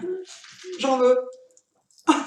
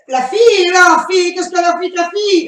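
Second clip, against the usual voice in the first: playful loud; 5 semitones higher, 6 dB louder than usual. Pretend. Playful loud wordplay on Laffy.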